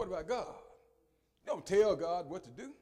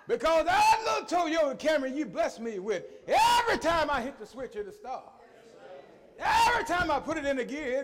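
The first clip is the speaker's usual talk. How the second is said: loud, higher, fervent praise; preaching at full force; 9 semitones higher, 9 dB louder than usual. Acted. Preacher shouting thanks to God for his car, loud and high.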